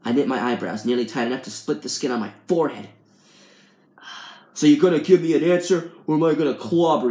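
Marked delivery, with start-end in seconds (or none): 4.5-7.1 raised, forceful threat; loud menacing demand; threatening. A narrator's reading. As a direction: lean on him like a bully demanding an answer. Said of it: "raises his fist threateningly".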